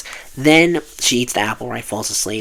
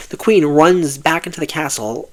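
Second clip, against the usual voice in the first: ordinary talk, nothing marked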